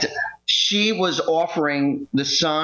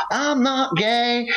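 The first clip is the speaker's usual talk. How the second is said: put-on indignant girlfriend; 9 semitones higher, much slower than usual.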